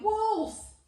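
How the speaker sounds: high, loud desperate shout; earnest cry for help. A narrator's reading. Shepherd boy flees the wolf, crying out in real terror. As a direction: scream it for real this time. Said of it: "crying, in earnest this time".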